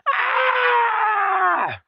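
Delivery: piercing high mock grandma scream; scream of death. Shown as a demonstration. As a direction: let out a shrieking death scream. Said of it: "what is the scream of death?".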